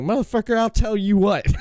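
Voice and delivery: mock Jim Cornette cursing at Thrasher; Cornette impression. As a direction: blow up like a furious old manager. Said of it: "He's like".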